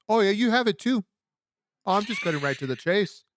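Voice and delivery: put-on a light London accent, up and bright; English accent. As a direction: imitate a London nurse lightly. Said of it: "I think she had an accent".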